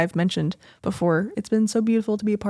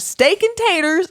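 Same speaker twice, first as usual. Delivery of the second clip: mock country drawl; 10 semitones higher, 7 dB louder, slower than usual. Mocking plain country food.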